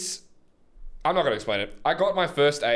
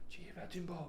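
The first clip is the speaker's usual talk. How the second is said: hushed, high gossiping voice; village gossip; 6 semitones higher, 17 dB quieter, much faster than usual. Acted. Villager spreads gossip to a neighbour, hushed. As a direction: pass on the scandal very softly. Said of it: "they'd be talking".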